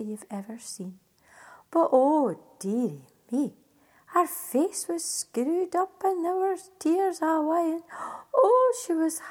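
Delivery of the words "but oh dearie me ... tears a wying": ordinary delivery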